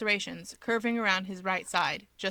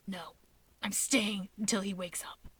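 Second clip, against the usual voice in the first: hushed, breathy worried whisper; whispered Shigaraki; 6 dB quieter than usual. A narrator's reading. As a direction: whisper it, anxious and clingy.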